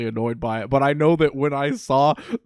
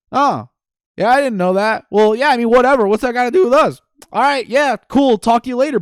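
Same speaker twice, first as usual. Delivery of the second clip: loud, higher-pitched mock-breezy voice; game character shrugging it off; 9 semitones higher, 8 dB louder than usual. Acting out Ralphie dismissing the confession, loud mock nonchalance. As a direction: brush it off loudly, pitched up. Ralphie's retold brush-off reply.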